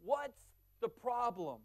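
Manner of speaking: normal talking voice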